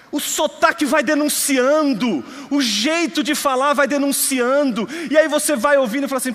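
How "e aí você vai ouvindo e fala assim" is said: ordinary delivery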